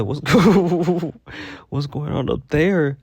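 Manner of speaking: high, loud voice bursting into a laugh; the coworker cracking up at Renee's hair, high and loud; Shalina's voice laughing. Retold or imitated. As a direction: squeal it out with a cackle like the coworker. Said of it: "you have to hear it in her voice".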